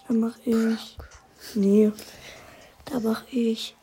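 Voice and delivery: soft unvoiced mumble; whispering to himself. Real, not acted. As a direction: whisper it to yourself. Whispering while choosing.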